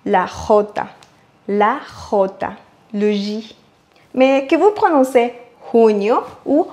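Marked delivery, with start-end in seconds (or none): 0.1-3.5 J scraped in the back of the throat like French R; Spain J. Shown as a demonstration. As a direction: rasp the J from the throat. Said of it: "on racle le fond de la gorge".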